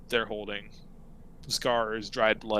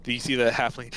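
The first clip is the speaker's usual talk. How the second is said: normal talking voice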